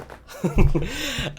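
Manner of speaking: bright burst of laughter; short high laugh. Real, not acted. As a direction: laugh at your own remark. Laugh after joke.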